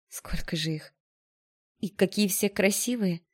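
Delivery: hushed start, lifting into admiration; awed wonder. A narrator's reading. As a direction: marvel at the sight. Heroine marvels at new dresses. (с восхищённым изумлением)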